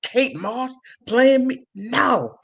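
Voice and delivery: lightly voiced, indignant and slow; a hint of a diva refusing, drawn out; mock indignant celebrity turning down the casting; mock outraged Amber Heard. She just would just.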